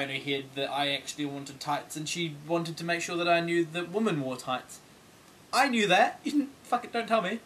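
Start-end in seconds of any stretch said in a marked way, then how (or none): 5.5-7.4 indignant outburst. Retold or imitated. Indignant retort to lady.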